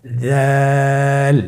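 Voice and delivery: দাল with the vowel held three counts; Arabic letter with three alif lengthening; three alif stretch. Shown as a demonstration. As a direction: draw দাল out long and thin. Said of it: "আবার বলুন".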